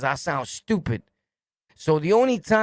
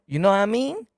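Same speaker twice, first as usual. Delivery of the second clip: higher, rising mock catchphrase; making fun; 4 semitones higher than usual. CO ridiculing inmates' ignorance, mocking imitation. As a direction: parrot their slang back to ridicule them. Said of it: "he's making fun of you".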